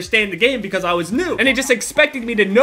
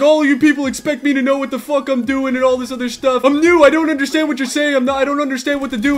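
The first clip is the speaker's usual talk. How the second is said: mock whiny protest, slightly raised; exasperated complaint; 3 semitones higher than usual. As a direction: imitate a flustered newbie pleading ignorance. Acts out flustered new player complaining.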